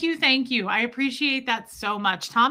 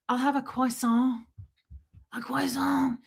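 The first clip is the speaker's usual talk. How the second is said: softer, higher Frenchified order; mock pretentious French accent; put-on French accent; 4 semitones higher, 3 dB quieter than usual. Host mocking someone ordering pastry, put-on French accent. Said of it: "at least go full out with the French".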